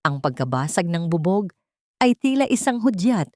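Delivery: ordinary delivery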